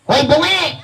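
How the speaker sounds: put-on high-pitched puppet child voice; puppet kid voice. Ventriloquist's puppet boy gawks at the crowd, high-pitched.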